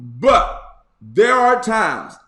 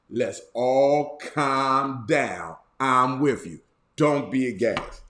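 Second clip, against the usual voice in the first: slow it right down and soothe them; calm, soothing God voice; 4 semitones lower, slower than usual. Pretend. Bishop's own version of God soothing the flock, slow and calm. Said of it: "This is my version".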